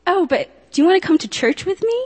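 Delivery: high, bright mock-excited ask with a rising end; cheerful invitation. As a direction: play the friend perking up with hope. Friend's sudden cheerful invitation.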